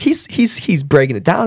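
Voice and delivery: high, stammering excitement; amazed realization. Retold or imitated. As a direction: pitch jumps up in retold amazement. Amazed reaction to a podcast.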